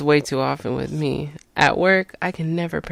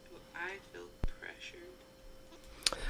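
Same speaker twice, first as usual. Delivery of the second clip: whispering; 8 semitones higher, 19 dB quieter, much slower than usual. Real, not acted. Whispered repeat of question.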